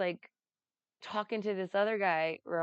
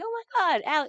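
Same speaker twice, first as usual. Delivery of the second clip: high excited gasp, louder; excited squeal; 13 semitones higher, 8 dB louder, much faster than usual. Retold or imitated. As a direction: gush over the gift. Kim's excited reaction.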